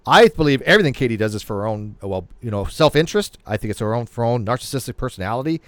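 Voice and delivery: usual speaking voice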